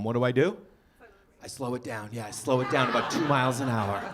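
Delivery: usual speaking voice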